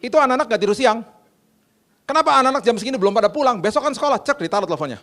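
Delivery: loud, high, fast telling-off; mock-angry phone scolding; scolding housekeeper. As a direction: imitate a fierce housekeeper laying into her boss. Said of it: "Saya dimarah-marahin loh".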